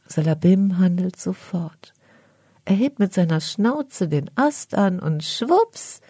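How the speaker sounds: usual speaking voice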